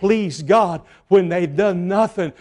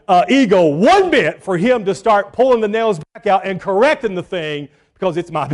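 ordinary delivery

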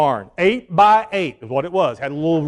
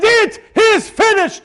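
high, slow proclaimed words; Christ's proclamation; 4 dB louder, slower than usual. Acted. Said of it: "Christ says".

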